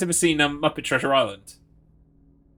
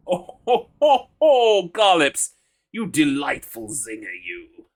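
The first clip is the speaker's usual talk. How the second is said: hammed-up chuckle; 2 semitones higher, 6 dB louder, slower than usual. Mock jolly laugh at joke.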